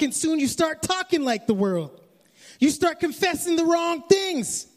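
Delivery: full-force pulpit exhortation; preaching. Acted. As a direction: preach it out to the church. Preaching to the congregation.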